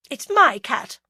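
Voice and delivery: a fierce, possessive snap; fierce claim. A narrator's reading. Agatha claiming the stray from the passerby, fiercely. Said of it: "as fiercely as a mother".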